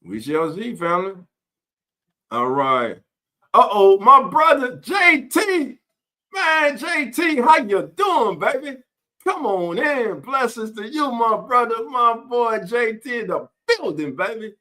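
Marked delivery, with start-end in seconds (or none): 3.5-14.5 hype shout-out. Real, not acted. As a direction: shout him into the room like a hype man. Greeting viewer J T on stream.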